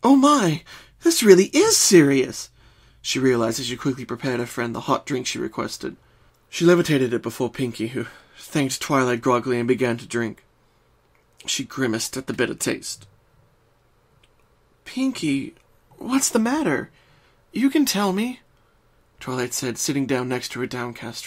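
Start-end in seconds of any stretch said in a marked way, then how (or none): 0.0-2.5 higher, louder alarmed. Acted. Twilight realises Pinkie is serious, louder and alarmed. 14.8-18.4 higher, gentle coaxing. Acted. Twilight sits by her downcast friend, gentle.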